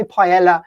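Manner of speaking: paella with a hard L, "pie-ELL-a"; English mispronunciation. Shown as a demonstration. Owning up to his bad Spanish, paella mispronounced with an L. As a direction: say paella anglicised, L sounded. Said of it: "which is pronounced badly".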